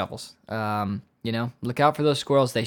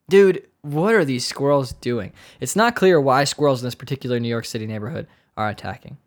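usual speaking voice

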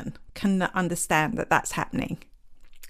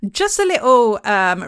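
slipping into Essex, louder at the start; Essex accent; 4 semitones higher, 6 dB louder than usual. Real, not acted. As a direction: let a broad Essex accent slip out. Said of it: "I sounded very Essex there".

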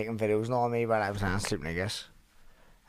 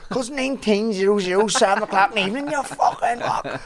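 high, loud mock scolding; retold insulting shout; 10 semitones higher, 6 dB louder than usual. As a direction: bark the insult with mock fury. Retold friend insulting him.

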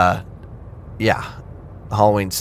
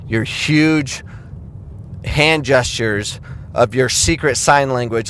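ordinary talk, nothing marked

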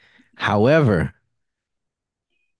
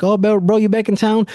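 higher, happy oh bro; thrilled to see his buddy; greeting the traveling friend, excited; 10 semitones higher than usual. Retold or imitated. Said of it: "I'm like".

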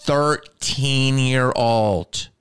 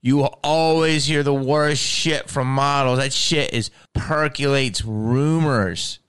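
ordinary talk, nothing marked

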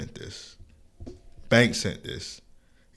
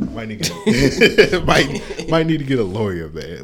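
words broken up by real laughter; laughing; slower than usual. Real, not acted. Host joking they need a lawyer, laughing. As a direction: try to get it out through laughs. Cracking up about getting sued.

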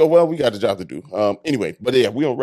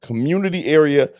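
show intro announcement; 3 semitones higher, slower than usual. Acted. Show host announcing the segment.

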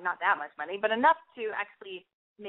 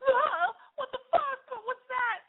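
hammed-up, high-pitched horrified squeal; horrified shock; slower than usual. Imagined date seeing her stomach scar, squealing in mock horror.

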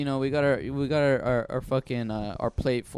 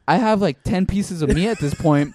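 loud, raised-pitch mock outrage; exasperated protest; 4 semitones higher, 7 dB louder than usual. Diner protesting more meat to waiter, loud comic exasperation. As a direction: protest loudly like a fed-up diner.

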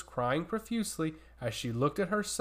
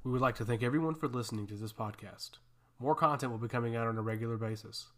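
quick announcer outro read; podcast outro; 7 semitones lower than usual. Acted. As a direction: rattle off the outro.

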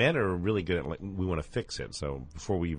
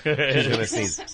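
laughing; 4 semitones higher, 6 dB louder, much slower than usual. Real, not acted. Co-host about to sneeze.

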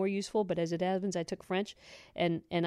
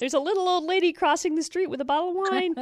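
higher, chirpy mock traffic reporter; 9 semitones higher than usual. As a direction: read it like a silly radio traffic bulletin. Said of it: "Kwarna traffic report".

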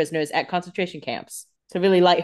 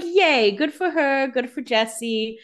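higher-pitched pleased cheer; mock cheering; 6 semitones higher, much slower than usual. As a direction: cheer them on in a raised voice, easing down. Cheering on the flirting couple.

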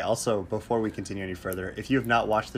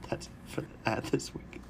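giggling whisper; 4 semitones higher, 9 dB quieter than usual. Real, not acted. Giggling close of fake ad.

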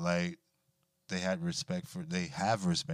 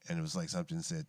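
normal talking voice

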